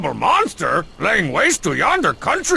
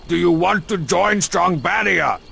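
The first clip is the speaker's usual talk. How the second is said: usual speaking voice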